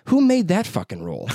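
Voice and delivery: high, mock-outraged retort; indignant comeback. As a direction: challenge the rule in mock outrage. Acted comeback to watch critics.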